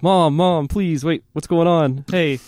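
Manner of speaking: retold child's anxious cry; little kid. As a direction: plead like a frightened kid calling for mom. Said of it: "being a little kid, I'm like".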